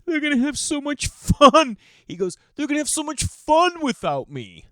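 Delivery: mock sob like a little boy; crying son. Dad imitating his crying son left out, high wail. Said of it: "So then he starts crying".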